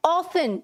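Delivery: often said as OFF-un, no T sound; often pronounced without its T; often with silent T. Shown as a demonstration. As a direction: say often like "awfun", the T left silent. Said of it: "हम इसे कैसे बोलते हैं".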